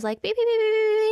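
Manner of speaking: high loud beep beep beeps; imitating car beeps. Telling listeners about her car's warning beeps, high mock beeping. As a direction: mimic a parking sensor beeping. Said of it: "beepy warning noises".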